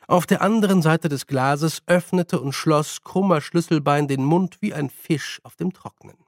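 usual speaking voice

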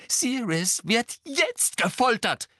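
slow, high, anguished call; desperate high-pitched exclamation; Harry crying out. A narrator's reading. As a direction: hammer out each word urgently. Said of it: "rief Harry".

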